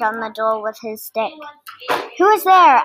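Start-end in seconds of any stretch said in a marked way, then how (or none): 2.2-2.8 higher, louder mildly gruff challenge. A narrator's reading. Badger answers a knock at his door, mildly gruff. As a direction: demand it of a late caller, a touch gruff. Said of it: "asked a gruff voice".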